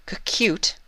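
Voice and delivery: high, flustered stammer on cute. Acted. Luna flustered at being called cute, stammering high. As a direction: trip over the word, caught off guard. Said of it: "she stammered".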